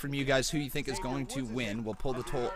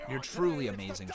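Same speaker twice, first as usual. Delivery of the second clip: normal talking voice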